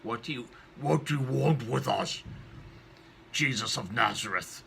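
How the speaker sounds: growling evil spirit; demonic voice. Acted. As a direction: challenge Jesus as the demon would. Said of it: "terrifying voice that sounds demonic".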